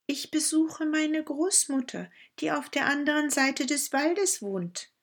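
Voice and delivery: put-on sweet and trusting, like a small child; little girl voice.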